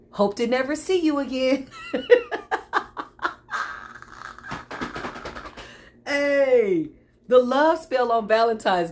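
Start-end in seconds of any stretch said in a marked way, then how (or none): 1.7-5.7 long, high wheezing laugh; gleeful cackle. Real, not acted. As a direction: cackle until the breath runs out. Laughs after a gleeful farewell.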